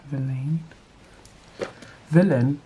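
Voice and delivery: ordinary talk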